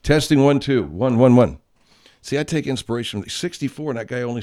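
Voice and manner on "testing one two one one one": loud, higher mic test; loud Southern mic check. Real, not acted. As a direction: call it into the mic.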